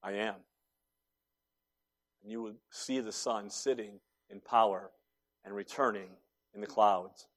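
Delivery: low, very soft, measured reply with pauses; quiet, solemn answer. Retold or imitated. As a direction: answer softly and low, unhurried. Jesus calmly answers the high priest.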